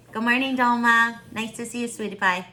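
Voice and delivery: normal talking voice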